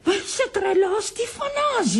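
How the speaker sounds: very high, panicked reproach; alarmed scolding. Acted. Woman fears they are overheard, alarmed scolding. Panics at his loud outcry.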